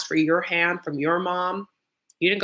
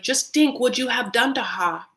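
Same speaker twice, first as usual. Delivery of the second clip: slow, higher put-on Nigerian accent; brother's accent; 6 semitones higher than usual. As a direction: imitate the brother's Nigerian accent, slowly admonishing. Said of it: "the brother's just like".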